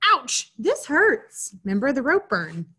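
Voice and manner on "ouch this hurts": higher, very slow pained yelp. Acted. Avery yelping as the rope burns his hand. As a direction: stretch out a hurting yelp. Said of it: "he yelped".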